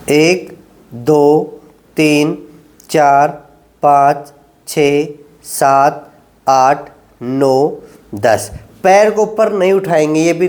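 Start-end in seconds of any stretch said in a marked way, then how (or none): 0.1-8.5 low, drawn-out counting through the hold; slow held count. Shown as a demonstration. Physio to patients, counting out a slow ten-second hold. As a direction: count each second slowly while holding the stretch.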